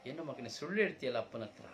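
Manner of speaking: loud, high, hurt father scolding; hurt reproach. Acted. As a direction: confront him with his tears. Father loudly reproaching his son. (ನೋವಿನಿಂದ ಜೋರಾಗಿ ಮಗನನ್ನು ಪ್ರಶ್ನಿಸುವ ತಂದೆ)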